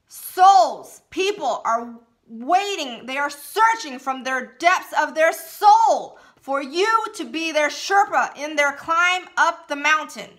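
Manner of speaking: full-voiced shout from the gut; bellowed affirmations; loud yell. Shown as a demonstration. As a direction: yell it out with all your power. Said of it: "I said it so loudly in the car".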